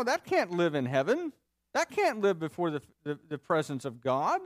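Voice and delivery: sceptical taunt with loud jabs; sneering disbelief, tossed out as a challenge; mocking questioner. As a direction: needle him with incredulous, challenging questions. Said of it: "the questioner is sort of mocking Paul".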